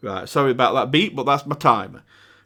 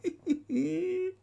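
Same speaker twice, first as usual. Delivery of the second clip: laughing in disbelief; 6 dB louder, slower than usual. Real, not acted. Amazed at long held note.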